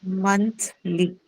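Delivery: "Monthly" slowly split, month... ly; syllable by syllable. Shown as a demonstration. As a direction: break "Monthly" into two drawn-out syllables. Teacher splits the word for students.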